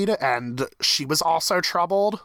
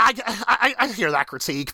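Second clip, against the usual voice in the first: normal talking voice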